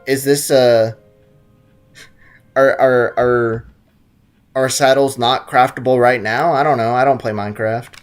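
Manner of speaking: usual speaking voice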